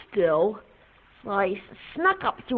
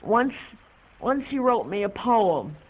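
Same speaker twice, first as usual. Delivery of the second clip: usual speaking voice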